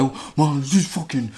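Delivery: put-on lightly slurred drunken mumbling; imitating being drunk. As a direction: act out a drunk losing the sentence. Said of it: "pretty drunk. So I'm here like".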